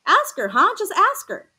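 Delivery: begging in a panic; frightened, voice climbing; scared pleading. A narrator's reading. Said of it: "I got so scared I was about to cry".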